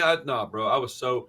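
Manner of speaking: normal talking voice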